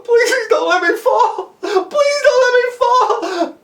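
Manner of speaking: high, slow, wailing plea; terrified pleading. Acted. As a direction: beg desperately, drawing the words out. Terrified boy begs his rescuer.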